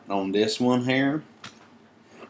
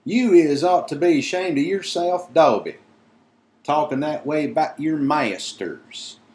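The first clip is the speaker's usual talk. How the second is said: lightly voiced elf, a touch higher and louder; a hint of a scolding elf; 7 semitones higher, 5 dB louder than usual. Winky scolding Dobby, lightly voiced elf. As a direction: scold him, lightly squeaky. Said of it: "squealed Winky furiously".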